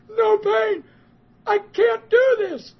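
loud, very high cry of disbelief; imitated crying shout. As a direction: act out an overwhelmed man bawling at full volume. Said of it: "crying twice as hard".

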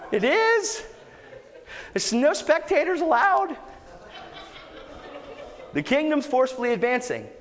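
high, strained-up preacher's plea; rally the congregation, voice pitched well up; urgent exhortation. Real, not acted. Preacher urging listeners off the sidelines, high-pitched exhortation.

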